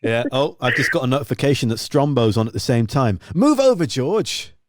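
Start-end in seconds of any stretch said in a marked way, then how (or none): 3.3-4.5 mock bold call-out. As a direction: shout it like a cheeky dare. Jokingly bumping rival host Strombo.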